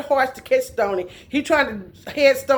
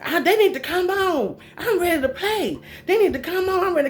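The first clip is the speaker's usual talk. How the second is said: impatient sister; 6 semitones higher than usual. Retold or imitated. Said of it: "she's impatient".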